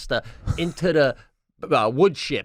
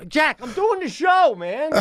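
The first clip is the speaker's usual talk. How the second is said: real shout. Real, not acted. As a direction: shout at her to keep it down. Yelling to wife mid-show.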